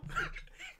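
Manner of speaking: very high squealing giggle; squealing laugh. Retold or imitated. The friend laughing at the takedown, a very high squealing giggle. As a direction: let out a shrill mock giggle.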